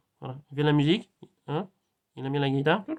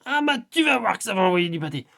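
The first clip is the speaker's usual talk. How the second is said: loud, high, stretched-out exclamations; hyped-up quote rising to a high peak; imitated excited outburst; 4 semitones higher, 6 dB louder than usual. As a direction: act out someone getting hugely fired up. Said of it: "et puis elle fait".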